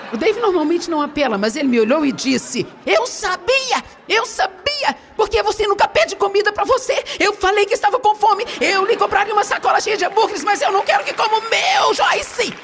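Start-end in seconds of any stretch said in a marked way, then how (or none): 2.9-12.5 retelling her husband's blow-up over the burger, mock angry; imitating angry husband. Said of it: "Aí ele apelou comigo".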